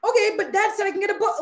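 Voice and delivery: put-on high, fast, laughing kid's protest; childhood self protesting. Retelling telling the school what dad promised, high and quick with a laugh. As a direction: play your fourth-grade self insisting, half laughing. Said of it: "And I was like".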